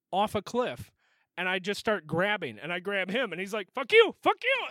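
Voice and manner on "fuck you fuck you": high-pitched frantic cursing; panicked mock yell. Friend grabbed at the cliff edge, panicked mock yell. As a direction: imitate someone fighting off a grab.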